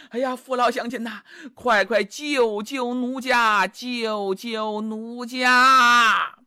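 slow, drawn-out wailing plea for help; coy spirit milking the villagers' pity; pleading damsel. Acted. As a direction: stretch each cry like a wronged young woman begging the crowd. Pipa spirit begs the crowd.

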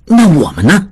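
anxious. A narrator's reading. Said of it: "有点急了".

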